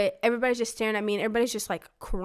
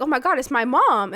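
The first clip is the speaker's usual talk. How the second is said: higher, louder acted delight; excited surprise; 4 semitones higher, 6 dB louder than usual. Seven-year-old seeing her mom at the door, excited surprise. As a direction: exclaim it like a thrilled child.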